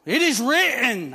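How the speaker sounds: loud, high, ringing proclamation; preaching at full force. Acted. Preacher to congregation, loud ringing proclamation.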